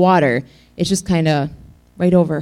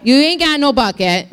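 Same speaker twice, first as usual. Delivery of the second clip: leaning into it, relishing every word; relished sassy retort at the well; savoring the sass; 7 semitones higher than usual. Retold or imitated. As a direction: say it like it feels good. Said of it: "Say that. That feels good".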